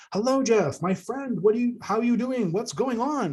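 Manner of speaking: high, beaming, friendly greeting; friendly elder. Retold or imitated. Elder welcomes her old friend Jeff, high and beaming. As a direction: pour friendliness into every word. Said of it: "she projects this, this friendliness out".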